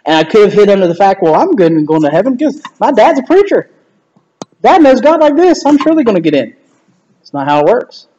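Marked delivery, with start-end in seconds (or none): 1.2-6.5 lightly acted cocky confidence, pitch raised; mock self-assurance. Preacher's kid counting on Dad for heaven, mock confident. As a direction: play at being sure of your place. Acts his younger self's false confidence.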